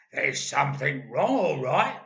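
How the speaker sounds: put-on loud, smug old man; gloating Mister Twit. A narrator's reading. Husband enjoying his prank, loud and smug. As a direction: savour his own trick out loud. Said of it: "said Mister Twit, beginning to enjoy himself".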